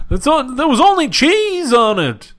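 high, slow Seinfeld standup voice; drawn-out observational comic voice; Jerry Seinfeld impression. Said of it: "Are we doing Seinfeld?".

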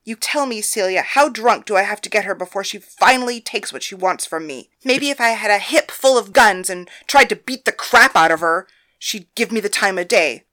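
put-on loud, fuming tirade about the stubborn girl; scorned lover rants to the other girls, furious and loud. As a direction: vent every insult at full volume. Thalia storms in ranting.

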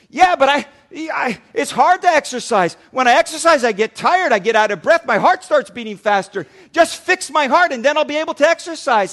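high, loud whine to the doctor; whiny plea. Retold or imitated. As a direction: complain loudly like a lazy patient. Patient whining at cardiologist.

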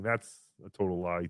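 normal talking voice

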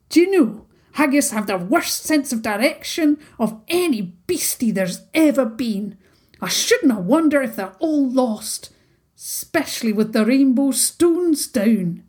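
put-on chattering haggis in a broad Scots accent; dramatic little haggis with a thick Scottish accent. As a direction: gossip it like an old Highland beastie. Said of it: "his thick accent".

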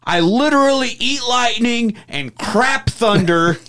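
high, slow boastful rant. Retold or imitated. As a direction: bellow it like a frazzled dad. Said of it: "during this rant at one point he goes".

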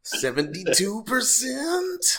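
high, slow, drawn-out disbelief; mock surprise. Joking about a slow download, slow mock amazement.

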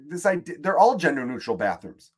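ordinary talk, nothing marked

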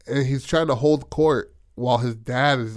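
normal talking voice

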